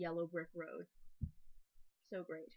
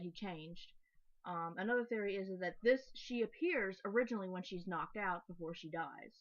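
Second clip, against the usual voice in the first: ordinary delivery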